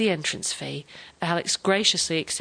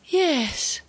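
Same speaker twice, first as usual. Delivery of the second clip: high, plaintive yes; resigned sadness in a raised voice; sad agreement; 8 semitones higher than usual. A narrator's reading. Grandfather accepts that his father is dead, sad agreement. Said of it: "he agreed sadly".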